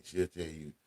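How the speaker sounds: ordinary delivery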